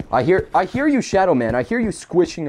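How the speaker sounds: high teasing call to an unseen enemy; taunting call. Real, not acted. Taunting the monster.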